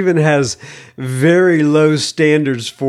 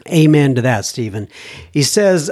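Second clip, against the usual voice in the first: ordinary talk, nothing marked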